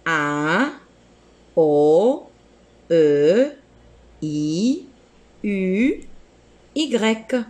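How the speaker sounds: each vowel said slowly, one by one; vowels said clearly. Shown as a demonstration. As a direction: spell out the French vowels with a pause after each. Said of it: "Les voyelles en français sont". (voyelles articulées une à une)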